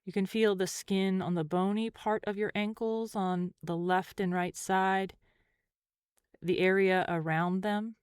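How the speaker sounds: calm, unhurried meditation voice with long pauses; guided meditation. Acted. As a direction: gently guide the listener through a body scan, leaving space between phrases.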